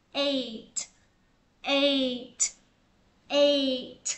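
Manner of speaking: "eight" ending on a crisp T; final T sound. Shown as a demonstration. Teacher demoing the final T of "eight" to students, crisp T ending. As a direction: release the T at the end of "eight". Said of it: "Thêm T đuôi vô mình sẽ đọc là".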